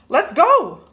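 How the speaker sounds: high, loud quack of a voice; Ducky Lucky quacking eagerly to the others; quacking duck. A narrator's reading. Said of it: "quacked Ducky Lucky".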